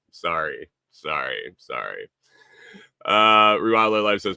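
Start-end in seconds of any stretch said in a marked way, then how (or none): 0.1-2.1 each sorry softer and lower; fading apology. Real, not acted. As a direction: trail off, apologizing into silence. Repeated apology fading away.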